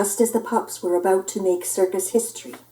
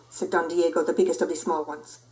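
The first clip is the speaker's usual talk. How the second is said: ordinary delivery, nothing marked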